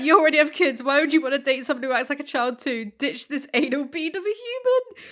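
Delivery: animated comment reading; excited reading. Real, not acted. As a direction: read the comment with relish.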